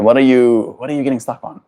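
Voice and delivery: normal talking voice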